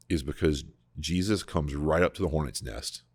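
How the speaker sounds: ordinary delivery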